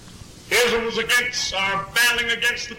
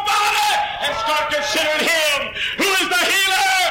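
full-force preaching; 9 semitones higher than usual. Acted. Preacher rousing the congregation, high ringing shouts. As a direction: lift it up and shout it out.

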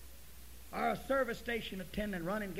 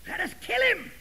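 high, loud shout of an angry mob; screaming men; 13 semitones higher, 11 dB louder than usual. Acted. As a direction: cry it out loudly, much higher. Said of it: "these men were screaming".

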